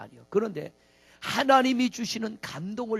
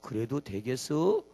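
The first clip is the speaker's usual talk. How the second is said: lower, slow Chungcheong customer; slow Chungcheong drawl; 8 semitones lower, 5 dB quieter, slower than usual. Retold or imitated. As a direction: drag the words like a Chungcheong local. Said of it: "먹은 사람 뭐라 그래요?". (느릿느릿한 충청도 사투리)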